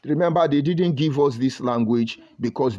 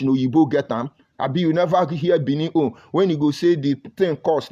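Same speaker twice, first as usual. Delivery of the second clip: ordinary talk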